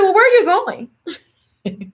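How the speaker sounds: high, slow question broken by laughter; laughing. Real, not acted. Daughter laughing at her mom, ready at the door, asking through laughter.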